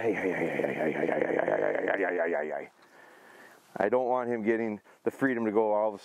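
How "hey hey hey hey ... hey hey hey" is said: much lower, rapid repeated hey; low warning. Real, not acted. Trainer warning his dog off, low repeated hey. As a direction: check the dog with low steady heys.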